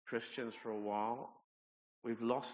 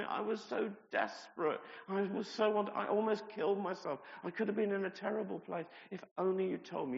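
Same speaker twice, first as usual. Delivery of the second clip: higher, louder desperate pleading; retold distress; 8 semitones higher, 5 dB louder than usual. Andrea telling him how desperate she was, higher and distraught. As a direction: let the hurt spill out. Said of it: "she was so upset with me".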